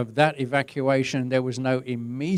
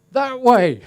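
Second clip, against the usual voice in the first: high, loud, cheeky answer; mock pointing; 12 semitones higher, 12 dB louder than usual. Steward sending tourists off the wrong way, loud and cheeky. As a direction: fire it off like a mock helpful steward.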